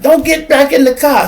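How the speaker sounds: high, loud cop command; imitated shout. Cop yelling at the woman not to get back in, loud high shout.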